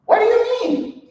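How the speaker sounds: high squealing surprise; laughing, high-pitched disbelief; mock shock. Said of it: "I was like".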